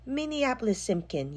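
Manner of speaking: mild yell. A narrator's reading. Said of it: "yelled Mom".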